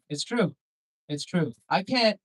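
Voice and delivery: ordinary talk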